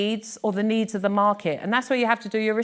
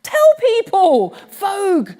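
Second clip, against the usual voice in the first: urging; 10 semitones higher, 7 dB louder, slower than usual. Retold or imitated. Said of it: "I was like".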